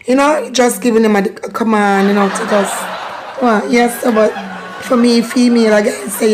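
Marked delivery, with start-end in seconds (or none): none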